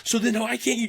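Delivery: high, ranting mock coach; mimicking angry coach. Coach ranting at the team about the unpaid intern, high mock anger. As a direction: rant like the pissed-off coach, pitched high. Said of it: "And he goes".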